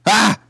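short high-pitched "Ah!"; startled cry. Acted. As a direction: cry out as if suddenly blinded. Cries out at being blinded.

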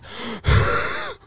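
imitated desperate inhale. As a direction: suck in air as if starved of breath. Imitates gasping after laps.

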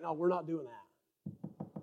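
low, slow and very soft; quiet retold refusal. Husband answering wife's offer to pay, quiet refusal. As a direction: imitate a firm no kept under the breath. Retells couch talk with wife.

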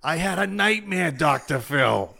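high-pitched mock yell, slowing on the end; Bernie. Bernie to psychiatrist Phil in the commercial, mock yelling. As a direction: imitate a wailing Boston commercial pitchman. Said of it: "just yelling at his, his wife".

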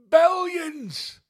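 billions shot up into a squeal; high-pitched gleeful billions; squeaky high billions. Acted. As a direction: squeal the word billions with glee. Repeated with comic squeal.

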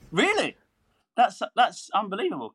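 high-pitched imitation of an astonished director; mock disbelief in a high voice; retold amazement. Voices the impressed director in story.

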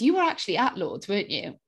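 ordinary talk

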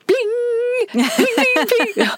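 very high, ringing plings; insistent imitated chime. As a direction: ring like a bell that keeps nagging.

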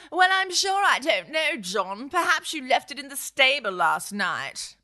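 high, prim lady voice; a genteel wife, pitched well up. Acted. Bertha answers her husband, in a high prim voice.

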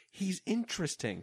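high, hesitant hype; mock sales pitch. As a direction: sell a dull guy to a friend. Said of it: "guy friend to her other girlfriend".

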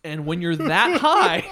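usual speaking voice

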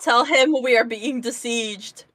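normal talking voice

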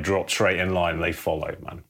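normal talking voice

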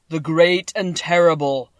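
a giant head proclaiming "the great and terrible"; booming Oz voice. A narrator's reading. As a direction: stretch "the great and terrible" out in a loud, slow boom. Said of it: "Dorothy heard a voice say".

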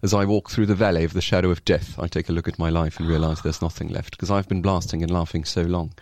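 measured Oxford-style British recitation of rap lyrics; Shakespearean British accent. Shown as a demonstration. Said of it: "je le faisais un peu comme Shakespeare".

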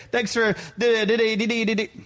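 high-pitched babble tumbling out; starstruck, squeaky fan gush. Acted. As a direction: dissolve into excited nonsense syllables. Acting out gushing fan babble.

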